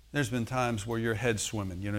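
normal talking voice

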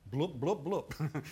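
playful imitation of going under; bubbling "bloop" noises. Preacher showing Peter going under the water, playful bloop noises. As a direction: pop out short sinking bubble sounds.

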